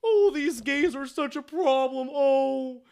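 high, loud, campy diva voice, homophobic [name]; bad [name] impression. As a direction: ham up a mock [name] bitching about gays. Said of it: "My bad [name] impression".

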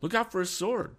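very high cry of alarm; urgent high-pitched shout; warning cry. A narrator's reading. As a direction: warn a friend of danger. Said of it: "cried Tom".

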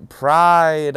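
excited topic reveal; big reveal. Real, not acted. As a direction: proclaim it like a headline.

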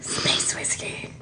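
slow, hushed whisper; whispered echo. Pretend. Co-reader echoing the space whiskey joke, slow whisper. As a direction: sneak it in as a whispered aside.

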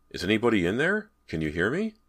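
searching call, pitch up. Acted. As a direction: call into the room for whoever is there. Calling to a voice behind door.

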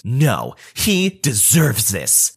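slow, put-on scornful mom voice; annoyed Karen. Karen brushing off the boy she stole from, slow put-on scorn. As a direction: let her sneer at the kid, slowly. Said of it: "but she says".

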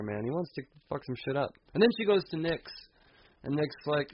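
ordinary delivery

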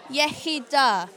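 ordinary talk, nothing marked

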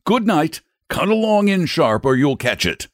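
lightly acted grown-up warning; brisk send-off. A narrator's reading. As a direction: shoo the children indoors. Man sends children indoors.

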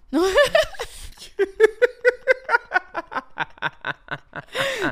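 long, high burst of laughter. Real, not acted. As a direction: laugh hard at her own joke. Laughing at her own story. (carcajada larga y aguda)

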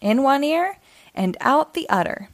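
ordinary delivery, nothing marked

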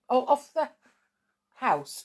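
usual speaking voice

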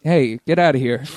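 lightly voiced gruff guard shouting off a trespasser; a hint of a tough guy chasing someone off; Falcor voice. Shown as a demonstration. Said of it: "he'd sound something like this".

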